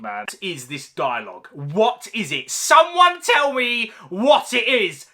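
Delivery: ordinary talk, nothing marked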